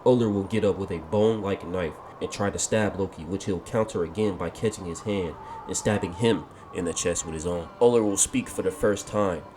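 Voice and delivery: usual speaking voice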